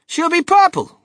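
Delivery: very high, loud exclamation; excited cry. A narrator's reading. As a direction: cry it out like a showman. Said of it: "cried Mister Wonka".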